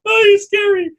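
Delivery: very high, loud squeal; scared kid. Retold or imitated. Speaker re-enacts his childhood reaction to the song, high squealed mock fright. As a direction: shriek it like a frightened little kid. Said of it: "being as a kid going like".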